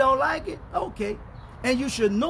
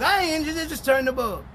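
usual speaking voice

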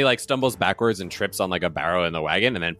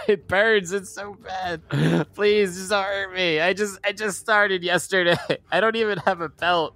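very high, hammed-up whimpering plea; mock-agonised falsetto begging; complaining victim; 13 semitones higher than usual. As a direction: whine like a wounded rookie henchman. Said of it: "like, complaining on the ground".

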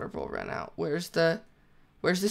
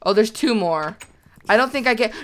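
ordinary talk, nothing marked

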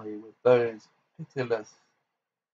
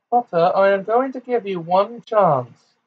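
high, raised villainess voice; shouted warning; 7 semitones higher, 4 dB louder than usual. A narrator's reading. Bellatrix gives Potter one last chance, shouting. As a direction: call out the ultimatum, high. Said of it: "shouted Bellatrix".

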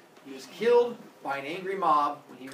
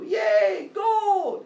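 high, loud shouted cheer; greedy cheer; 12 dB louder than usual. Acted. Crowd cheers at gold.

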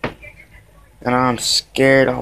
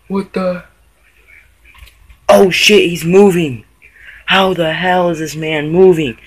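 real alarmed outcry, raised pitch; startled panic; 4 semitones higher, 5 dB louder than usual. Real, not acted. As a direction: exclaim loudly in disbelief and alarm. Panicking as the moth moves.

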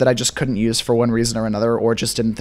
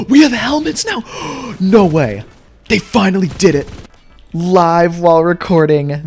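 overjoyed; 2 semitones higher, 3 dB louder than usual. Real, not acted. Player spots the long-awaited helmets in the game, overjoyed. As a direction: burst out in amazed delight. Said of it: "I'm so happy".

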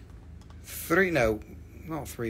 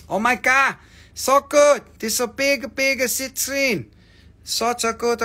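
gushing; 7 semitones higher than usual. Real, not acted. Seller raving to the stream about a stone, excited squeal. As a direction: squeal it out like you can't believe it.

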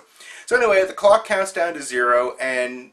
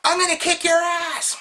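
put-on much higher, bratty kid voice taunting; mock teenage trash talk. Young opponent taunting the team in the lobby, mock bratty kid. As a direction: squeak it out like a cocky young kid. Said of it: "sixteen-year-old kids or younger going".

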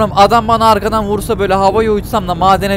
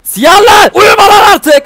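high, very loud yell at another driver; exasperated full-voice shout; real shouting; 12 semitones higher, 10 dB louder, slower than usual. Real, not acted. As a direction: bellow to wake up a dozing driver. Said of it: "Beni duyuyorlar bağırınca ya".

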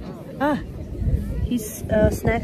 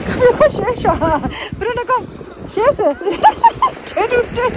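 excited dog talk; 11 semitones higher than usual. Real, not acted. Owner playing with dog Bruno at the beach, high excited voice. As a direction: hype the dog up to shake.

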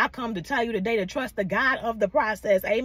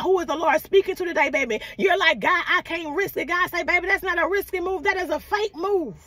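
preaching at full force; 6 semitones higher than usual. Acted. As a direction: preach it hard, pushing the crowd. Preacher rises again.

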